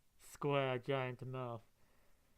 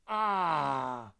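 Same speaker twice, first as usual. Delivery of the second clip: sharp cry; 9 dB louder than usual. Real, not acted. Reacting to a scene.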